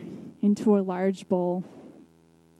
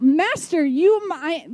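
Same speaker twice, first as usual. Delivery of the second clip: high sputtering outburst; bursting out; 7 semitones higher, slower than usual. Retold or imitated. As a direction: blurt it out in a rush, higher. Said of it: "He bursts out".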